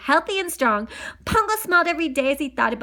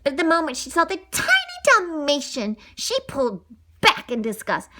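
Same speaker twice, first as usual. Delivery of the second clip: plain reading voice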